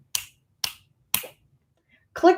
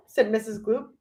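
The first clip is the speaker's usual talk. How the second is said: ordinary delivery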